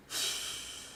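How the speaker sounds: long, soft breathy sigh; resigned sigh. Real, not acted. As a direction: let out a defeated sigh. Resigned after losing the race.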